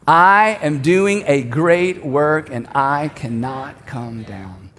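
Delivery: very slow, evenly spaced for a crowd; leading group recitation. Shown as a demonstration. Preacher leads everyone saying it together, slow recitation. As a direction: draw each word out, drop low at the close. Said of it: "I want us to say it one more time".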